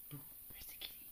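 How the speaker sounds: hushed playful whisper. Real, not acted. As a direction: whisper it like a secret. Playful hushed question to dog.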